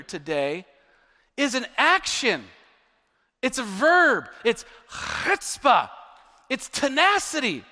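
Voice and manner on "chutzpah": chutzpah with a long guttural Hebrew "ch"; Hebrew accent. Shown as a demonstration. Preacher defining faith as action, Hebrew accent. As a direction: drag the Hebrew "ch" of chutzpah through the throat. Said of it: "I can't even do the accent".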